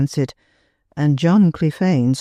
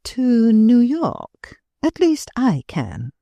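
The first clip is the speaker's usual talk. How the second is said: slow, cool and unhurried; calm, cool reply; 7 semitones higher, slower than usual. A narrator's reading. Kate coolly tells the dinner party where she's going, calm. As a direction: answer the table with calm composure. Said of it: "surveyed her calmly".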